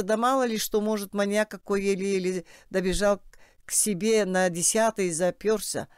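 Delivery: ordinary talk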